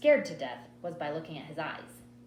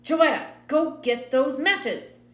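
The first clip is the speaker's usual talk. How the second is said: loud, slow, higher-pitched command; angry mother's order; 7 semitones higher, 9 dB louder, slower than usual. A narrator's reading. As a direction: order the child with hard insistence. Furious mother repeats her order.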